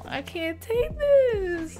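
high, slow drawn-out whine; overwhelmed whine. Real, not acted. Fan overwhelmed by the episode, high whine. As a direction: whine it up high, stretching the end.

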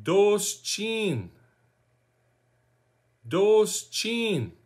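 Doshchin as DOHSH-CHEEN, slowly drawn out; teaching pronunciation. Shown as a demonstration. Teacher showing learners how to say where from, slow DOHSH-CHEEN for Doshchin. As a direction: model "Doshchin" slowly, both vowels long. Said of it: "Can you guys try saying that?".

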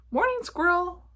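high, loud, sing-out hello; cheerful Rabbit greeting. A narrator's reading. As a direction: call it out high as a friendly storybook rabbit. Rabbit greets Squirrel in the story.

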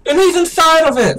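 excited shout. Real, not acted. Excited at the final boss.

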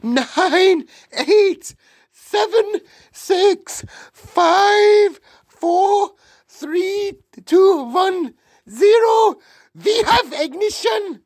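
very high, loud, screeched countdown; witch's countdown cry. A narrator's reading. Witch counting down to the mouse spell, crying out. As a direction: screech each number as a separate cry.

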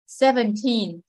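put-on Seventeen with TEEN stressed; seven-TEEN, last syllable prominent; stress on second syllable. Shown as a demonstration. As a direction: put the weight on the end of Seventeen. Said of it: "nhấn trọng âm số hai".

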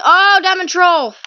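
high, loud exclamation; loud outcry. Real, not acted. Gamer tricked by diamond troll, high loud outcry. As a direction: cry out at the trick.

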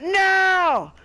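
high, loud, drawn-out cry; anguished shout. Real, not acted. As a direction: burst into a loud wail of defeat. Waiting ends in failure.

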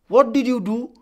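loud, high scolding question; cross. A narrator's reading. Ma confronts the rickshaw puller at her door, cross and loud. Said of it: "Ma looked at him crossly".

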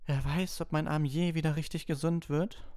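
high, put-on self-pity; fake suffering. A narrator's reading. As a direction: pretend to fret over the injury, voice pitched high. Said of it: "falschen Seufzer hören".

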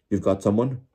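loud, disbelieving question; shouted. A narrator's reading. Official calling to the searcher, shouting in disbelief. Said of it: "shouted Mister Crouch".

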